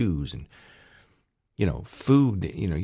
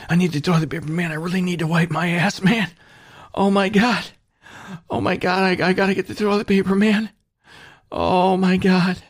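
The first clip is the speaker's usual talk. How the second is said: much higher, frantic junkie voice begging for toilet paper; desperate junkie; 13 semitones higher than usual. Acted. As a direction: whine it out like an addict craving a fix. Acted toilet paper junkie craving.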